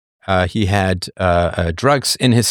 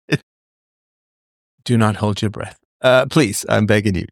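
ordinary talk, nothing marked

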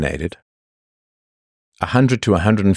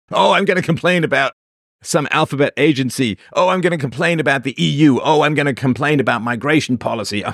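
mocking whiner; 8 semitones higher than usual. Mocking complainers.

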